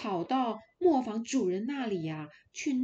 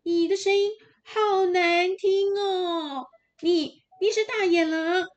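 higher and louder little goats shouting back; shouting kids; 9 semitones higher, 9 dB louder than usual. Acted. Little goats shouting at the wolf through the door, loud and high. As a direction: kids yelling to chase the wolf off. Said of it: "他们就大声地说".

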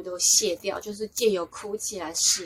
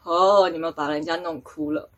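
offhand, dry jibe; cool teasing remark; 3 semitones lower, 3 dB louder than usual. Retold or imitated. Passer-by god teasing the angels, coolly offhand. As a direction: toss it out coolly, as a bystander. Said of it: "他就凉凉的说".

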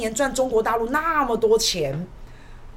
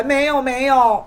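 slow, louder drawn-out protest; mock nervous denial; 3 semitones higher, 6 dB louder, slower than usual. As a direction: plead it like a caught official.

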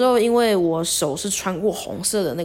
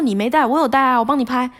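higher, lightly voiced cheery coach; coach cheerful; 6 semitones higher than usual. Coach offering his phone, lightly voiced higher. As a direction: brightly insist on taking the photo. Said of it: "他说".

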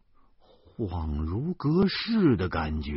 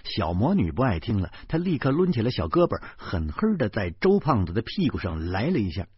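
plain reading voice

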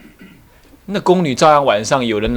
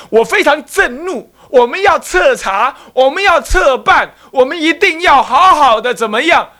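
mock official outrage; 12 semitones higher, 3 dB louder than usual. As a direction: parody a bureaucrat vowing a thorough probe. Mocking modern officials' empty statements.

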